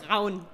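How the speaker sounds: Braun with a throaty German R. Shown as a demonstration. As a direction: model Braun for a learner to copy. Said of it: "Can you do that?".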